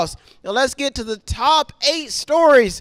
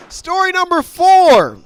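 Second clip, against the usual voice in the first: high, slow showman call; announcer; 9 semitones higher, 7 dB louder than usual. Acted. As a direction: announce it like a game show host. Host announces story.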